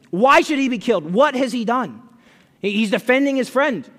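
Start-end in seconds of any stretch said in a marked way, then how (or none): 0.1-2.0 loud, high, emphatic challenge; indignant protest. A narrator's reading. Preacher reads Jonathan defending his friend to Saul, indignant protest.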